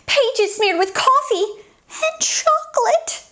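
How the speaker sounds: scandalised gossip. Acted. Beatrix gossip continues.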